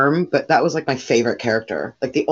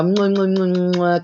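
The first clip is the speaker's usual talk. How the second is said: smacking kiss noises, higher; air kisses; 5 semitones higher, slower than usual. Retold or imitated. Cashier mocking her usual people-pleasing, kissy smacks. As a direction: blow fawning kisses like a suck-up. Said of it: "little ass kisser".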